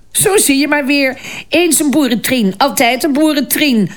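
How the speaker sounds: high, mocking jeer; mean cackling court lady. A narrator's reading. Court lady mocking the new girl, high spiteful jeer. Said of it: "schaterde een van hen gemeen".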